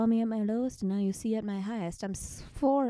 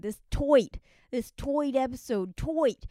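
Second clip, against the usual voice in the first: "toit" said as toit, a Brooklyn-style vowel; toit accent; 4 semitones higher, 6 dB louder than usual. Shown as a demonstration. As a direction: stretch "toit" into a New York toit.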